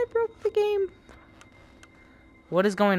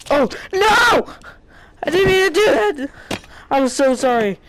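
horrified cry; 9 semitones higher, 4 dB louder than usual. Real, not acted. Accidentally hurt a game character.

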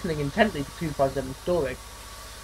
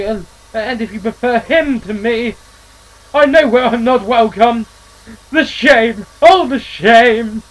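louder, slow, hammed-up self-pity; wounded hurt; 4 semitones higher, 7 dB louder, slower than usual. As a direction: wallow in the shame. Eustace wounded, theatrical exit.

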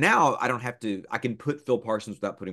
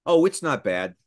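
ordinary talk, nothing marked

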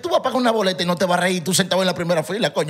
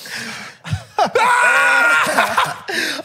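shrieking laugh. Real, not acted. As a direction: crack up hard. Loud laughter at joke. (carcajada aguda y fuerte)

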